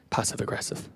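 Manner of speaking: near-whispered, hesitant accusation; hushed. Retold or imitated. Son gingerly accuses his mum, hushed. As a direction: drop low and barely let it out.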